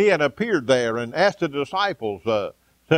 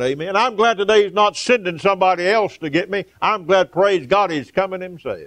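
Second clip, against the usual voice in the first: rising, louder revival preaching; fervent preaching; 5 semitones higher, 3 dB louder than usual. Acted. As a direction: preach it out with fire to the congregation. Preaching at full force.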